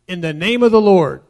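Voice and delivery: loud, forceful proclamation; declared formula. Shown as a demonstration. Preacher quoting a misused formula, loud declaration. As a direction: boom it out as a set phrase. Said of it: "if you say".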